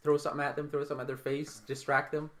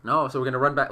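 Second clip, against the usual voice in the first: usual speaking voice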